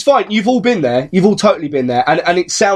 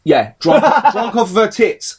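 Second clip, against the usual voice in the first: ordinary talk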